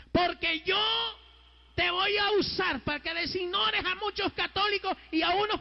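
high, ringing proclamation to a crowd; full-force preaching. Acted. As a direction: preach it out on a high sustained pitch. Preaches God's words at full force.

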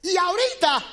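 high, loud shouted preaching; Jesus shouting to his disciples, high and loud; Jesus calling out. Acted. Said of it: "Jesús lo que le está diciendo".

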